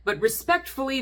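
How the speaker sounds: ordinary delivery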